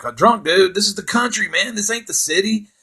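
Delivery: high-pitched, disbelieving echo; incredulous objection. Retold or imitated. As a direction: throw the suggestion back in disbelief. Scoffs at friend's drunk dude suggestion.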